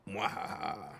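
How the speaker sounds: evil villain laugh, hammed up; sneering panto villain. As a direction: mwahaha like a panto baddie. Evil laugh after hiding drink.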